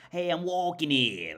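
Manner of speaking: loud, high mock New York accent; hammed-up New York street accent, slow and loud. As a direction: stretch it out like a brash New Yorker. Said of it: "Like, that accent".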